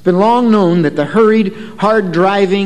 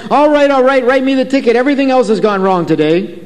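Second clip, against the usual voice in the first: mildly agitated, raised pitch easing down toward the end; 7 semitones higher than usual. Retold or imitated. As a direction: act out a flustered driver giving in. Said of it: "in an agitated voice".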